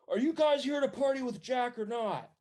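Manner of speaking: high, loud shout across a distance; guard calling out. Acted. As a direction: holler it at the intruders as the other guy. Enemy guard calls out to the players.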